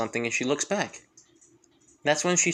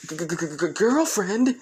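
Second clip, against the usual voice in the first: shocked stammer; 4 semitones higher than usual. Acted. Karin asked to be Izuku's girlfriend, shocked stammering. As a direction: stammer in disbelief, then blurt it out. Shocked girl echoes the proposal.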